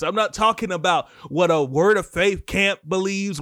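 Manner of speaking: fired-up preacher's exhortation; impassioned preaching. Real, not acted. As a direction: press the lesson hard on the congregation. Preaches at full force.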